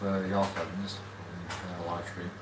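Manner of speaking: usual speaking voice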